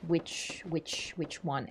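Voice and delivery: hushed, slow, puzzled question; confused and quiet. Retold or imitated. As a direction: ask it quietly, lost and unsure. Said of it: "And I was like".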